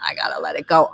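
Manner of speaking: quick, breathy half-whisper to herself; hushed resigned aside. Retold or imitated. [name] recalling letting her old job go, half-whispered. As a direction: rush it out under the breath, resigned. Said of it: "I was like".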